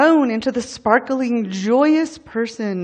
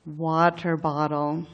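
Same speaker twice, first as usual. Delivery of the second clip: low, soft imitation of a worn-out child; retold halting words; 6 semitones lower, 7 dB quieter than usual. Parent retelling worn-out child's words after sobbing, low and halting. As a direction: quote it quietly, with a long halting pause. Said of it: "found a few halting and hiccuping words".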